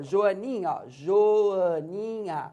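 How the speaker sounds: Joaninha read out slowly, syllable by syllable. Shown as a demonstration. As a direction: sound out Joaninha slowly, one syllable at a time. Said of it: "Então, olha".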